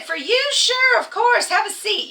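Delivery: hammed-up keen employee; overly eager reply. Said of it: "I said".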